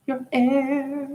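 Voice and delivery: squeaky high falsetto repeat. Shown as a demonstration. Repeating the word in a high falsetto. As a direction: lift it way up into falsetto.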